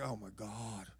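very soft, low, worn-out mutter; exhausted groan. Acted. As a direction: sag under it, spent. Worn out by granddaughter.

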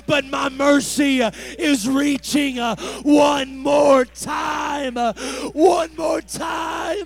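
loud preaching plea, rising in force; compassionate voice of mercy. Acted. Preacher voicing mercy embracing the sinner, loud and pleading. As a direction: preach it full out, begging them back. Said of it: "The voice of mercy has a tone of compassion".

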